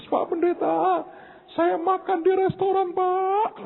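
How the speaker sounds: high, tearful woman's voice; imitating crying woman. Poor widow phoning the pastor in tears, high tearful voice.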